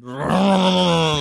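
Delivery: loud roaring engine imitation; drawn-out engine roar; car engine noise. Imitating his car with no muffler.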